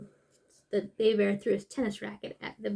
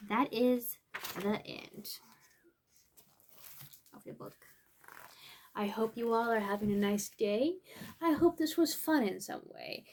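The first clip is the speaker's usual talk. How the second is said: ordinary delivery, nothing marked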